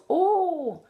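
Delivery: "Oh" high and swooping, gliding vowel; a high, rounded British "Oh". Shown as a demonstration. Coach showing what to avoid, high gliding British "Oh". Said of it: "like the British are very".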